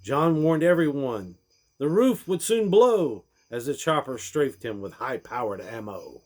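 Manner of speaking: ordinary delivery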